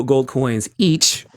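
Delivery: ordinary talk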